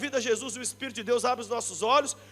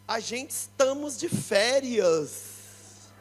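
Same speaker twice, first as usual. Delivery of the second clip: put-on very slow, drawn-out teen excuse; lazy teenage son; 4 dB quieter, much slower than usual. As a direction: stretch it out like a lazy kid on holiday. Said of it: "um falou assim".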